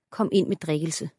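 usual speaking voice

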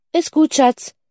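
high-pitched call from another room. A narrator's reading. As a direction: sing it out to a child down the hall. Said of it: "rief ihre Mutter aus dem Wohnzimmer".

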